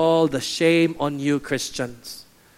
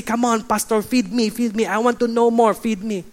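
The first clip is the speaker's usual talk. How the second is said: higher-pitched, insistent mock plea; demanding churchgoer; 6 semitones higher than usual. Churchgoer asking pastor to feed them, mock-demanding. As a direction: pester the pastor for more. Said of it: "they have this mentality".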